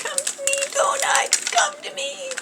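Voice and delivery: high playful coaxing. Pretend. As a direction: sweet-talk the donut out. Coaxing stuck donut out.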